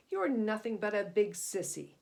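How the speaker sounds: loud mocking sneer. Shark taunting Cyrus, loud sneer. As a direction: look down on him and jeer. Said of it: "sneered the shark".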